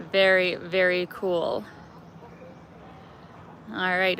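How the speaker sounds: ordinary talk, nothing marked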